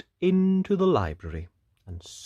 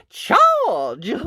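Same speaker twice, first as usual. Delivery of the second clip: high, loud battle cry; knight's yell; 10 semitones higher, 8 dB louder than usual. A narrator's reading. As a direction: yell the order to attack. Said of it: "yelled the knight".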